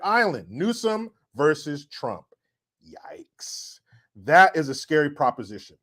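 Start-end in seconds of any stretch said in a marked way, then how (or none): 2.9-3.8 soft breathless whisper; hushed yikes. Real, not acted. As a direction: mouth it in dread. Whispered reaction.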